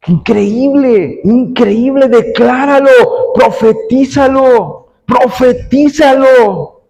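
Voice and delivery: high, very drawn-out exclamations; impassioned preaching. Acted. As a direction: proclaim each word slowly in a high voice. (proclamación exaltada, aguda y muy alargada)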